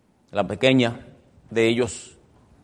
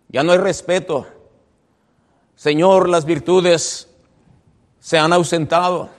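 loud, raised-pitch preached lament; psalmist's lament; 4 semitones higher, 5 dB louder than usual. Acted. Preacher voicing the psalmist's complaint to the Lord, lamenting groan. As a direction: cry out to God in mild lament. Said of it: "Es un gemir del salmista".

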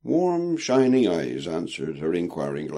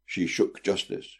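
usual speaking voice

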